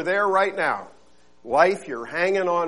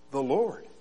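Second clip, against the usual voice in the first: a hint of a calm, low God voice; calm divine answer; 4 semitones lower, 5 dB quieter than usual. God answering the man below, calm. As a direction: answer simply and calmly. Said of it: "the voice returned".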